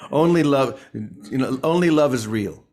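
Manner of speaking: ordinary talk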